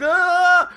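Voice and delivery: long high scream. Retold or imitated. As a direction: scream in horror. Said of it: "and I'm like".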